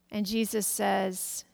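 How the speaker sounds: ordinary delivery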